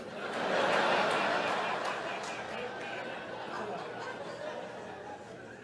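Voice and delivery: long silent wheeze laugh; breathless giggle fit; high wheezing laugh. Real, not acted. Laughing at own story.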